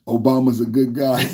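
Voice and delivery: scoffing laugh at an imagined defender. Real, not acted. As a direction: say it, then burst out laughing. Mocking a chat comment, laughing.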